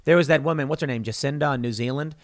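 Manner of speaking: normal talking voice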